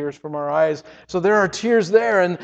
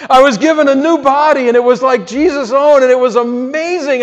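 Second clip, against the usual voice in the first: louder and higher, bursting with excitement; thrilled; 6 semitones higher, 6 dB louder than usual. Real, not acted. [name] describing his new body to listeners, thrilled. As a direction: tell it like you can't contain it.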